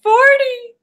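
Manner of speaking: high, loud, crying wail as Sally; Sally freaking out. Retold or imitated. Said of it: "And she goes".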